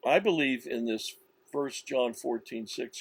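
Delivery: ordinary delivery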